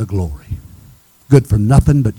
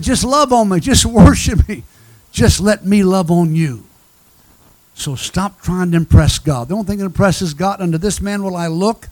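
raised-pitch, driving revival preaching; preaching exhortation; 6 semitones higher, 4 dB louder than usual. Real, not acted. Preacher calling the church back to Jesus, preaching exhortation. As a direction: urge the congregation with mounting insistence.

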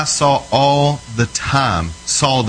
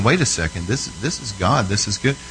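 soft, lower, as if thinking to oneself; dawning realization; 4 semitones lower, 5 dB quieter than usual. Retold or imitated. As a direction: voice a quiet, retold realization. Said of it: "she started realizing".

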